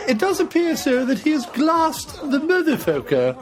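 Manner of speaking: high, laughing mock detective; high squeal of delight as the detective; laughing mock report. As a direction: report the glassing through laughter.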